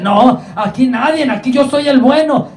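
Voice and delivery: high, indignant mock outburst of a rich king; enojo imitado. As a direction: act out a proud ruler's angry refusal. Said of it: "se enojó tanto porque dice". (enojado, imitando a un rey soberbio)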